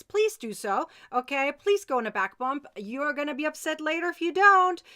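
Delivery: usual speaking voice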